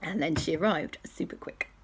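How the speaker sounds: ordinary delivery, nothing marked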